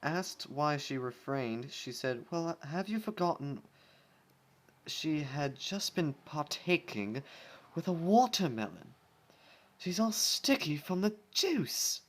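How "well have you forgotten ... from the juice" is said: prim lady voice. Acted. Rarity voiced in fanfic.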